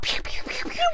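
rapid "pew" gunshot noises; playful toy-gun sound effects; imitated gunfire. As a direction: fire off little mouth-made pistol shots. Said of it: "He was firing guns off".

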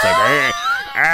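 calling out like a nasty dad; gruff dad voice. Retold or imitated. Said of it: "her fucking piece of shit dad's like".

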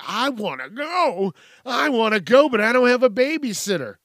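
high, loud whiny imitation; mock whining. Parent protests having no babysitter, mock whining.

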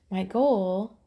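words around a sip of coffee; while sipping a drink. Real, not acted. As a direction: talk mid-sip of coffee. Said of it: "sorry, I'm drinking my really good organic coffee".

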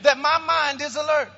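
high, ringing chant-like declaration in short phrases; congregational declaration. Real, not acted. Pastor leading the church in its Bible confession, bold high proclamation. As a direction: proclaim it phrase by phrase for the crowd to repeat.

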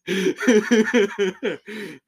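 loud high burst of laughter; really cracking up. Real, not acted. Laughing hard at a friend's joke.

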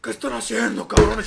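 retold furious shout at the kids; angry yell. Uncle yelling at boys splashing water, angry shout.

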